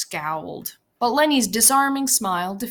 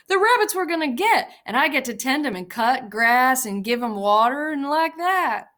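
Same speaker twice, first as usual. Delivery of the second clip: higher and faster, eager; Lenny excited; 6 semitones higher, 4 dB louder than usual. A narrator's reading. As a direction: rattle off the dream happily.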